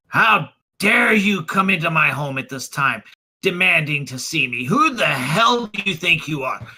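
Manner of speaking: outraged magistrate; indignant outrage. Acted. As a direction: confront an intruder in your own home. Magistrate confronts night intruder.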